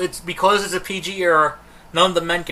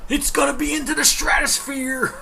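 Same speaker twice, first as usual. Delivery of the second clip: mock hype; 10 semitones higher than usual. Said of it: "it's just gonna be like".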